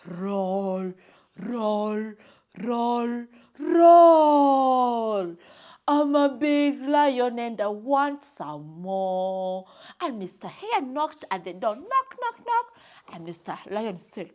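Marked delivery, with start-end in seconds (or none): 0.1-9.7 loud, drawn-out lion roar; big storybook lion, booming and stretched out. A narrator's reading. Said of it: "Mister Lion was still roaring". 11.8-12.7 high, light knock-knock; three quick raps voiced high; acted knocking. A narrator's reading. Acts out knocking on the door.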